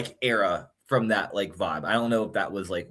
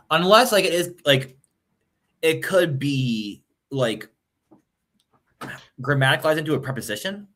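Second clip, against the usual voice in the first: excited; 7 semitones higher than usual. Real, not acted. Excited linguistic brainstorming.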